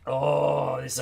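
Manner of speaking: loud, drawn-out awed "oh"; impressed exclamation. Real, not acted. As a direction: let out a big, real "oh" of admiration. Sees the strong Alabama team.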